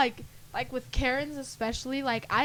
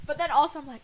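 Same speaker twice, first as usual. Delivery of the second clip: usual speaking voice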